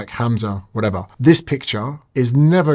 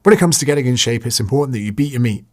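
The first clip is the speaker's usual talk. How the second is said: usual speaking voice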